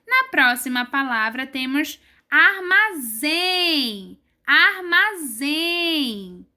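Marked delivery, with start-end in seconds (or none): 2.3-6.4 armazém very slow, with a long strong final zém; armazém syllable by syllable, last one held; stress on ZÉM. Shown as a demonstration. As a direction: stretch armazém and lean on zém. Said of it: "O zen é falado mais forte".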